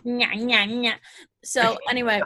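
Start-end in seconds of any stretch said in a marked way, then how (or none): 0.0-0.9 put-on childish nya-nya taunt; sing-song taunting. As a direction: taunt like a bratty kid. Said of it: "Never make that noise on this podcast".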